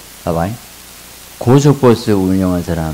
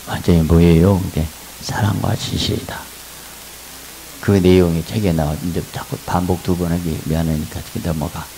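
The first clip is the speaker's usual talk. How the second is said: normal talking voice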